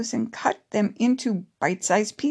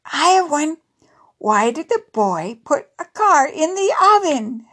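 put-on higher-pitched kid telling a joke; giggly child; 7 semitones higher, 4 dB louder than usual. A narrator's reading. Anton taking a turn at jokes, giggly and high. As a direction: pose the riddle in a giggly, raised voice. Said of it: "giggled Anton".

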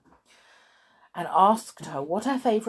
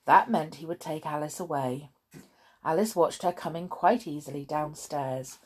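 plain reading voice

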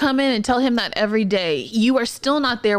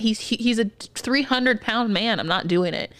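ordinary talk, nothing marked

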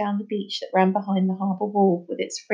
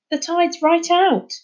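calling up; 9 semitones higher, 3 dB louder, slower than usual. Acted. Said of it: "he called up to them".

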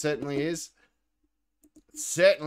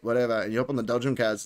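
ordinary delivery

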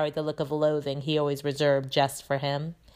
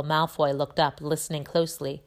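ordinary delivery, nothing marked

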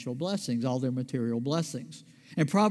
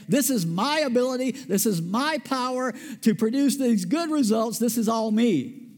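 raised, louder proud claim to the power; boastful claim; 7 semitones higher, 4 dB louder than usual. Pretend. Preacher voicing Joseph as if he boasted, proud and louder. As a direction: puff up and take all the credit.

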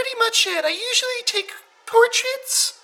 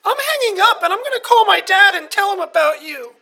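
much louder, huffy threat; angry threat; 8 dB louder than usual. Acted. Caller to host, loud angry threat. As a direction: storm off the call, loud.

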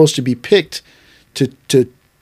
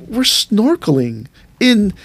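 higher, slow disbelieving retort; as if it's ridiculous to worry; incredulous; 7 semitones higher, slower than usual. Retold or imitated. Teacher answering a worried student, mock disbelief.

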